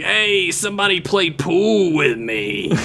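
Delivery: put-on slow, slurry barroom drunk; drunk guy voice. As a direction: lean into the sloppy drunk. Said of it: "I like your drunk guy voice".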